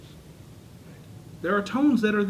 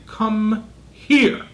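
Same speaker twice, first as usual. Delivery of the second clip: stern raised call; 3 semitones higher, 8 dB louder than usual. Shown as a demonstration. A parent losing patience calling the child, loud and stern. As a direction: take "Come here." up a notch, sharp and loud. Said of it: "they change their voice and they say".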